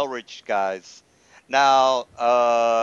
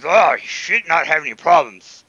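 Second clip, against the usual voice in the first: orc leader voice; 3 semitones higher, 7 dB louder than usual. Acted.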